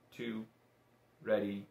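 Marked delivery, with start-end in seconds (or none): none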